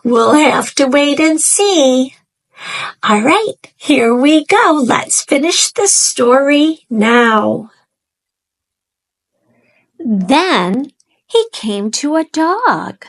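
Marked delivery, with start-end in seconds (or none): none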